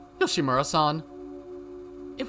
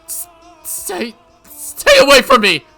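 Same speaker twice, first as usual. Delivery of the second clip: terrified; 15 dB louder than usual. Acted. Mion backing away from Yoshimura, terrified. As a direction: stammer rising to a high scream.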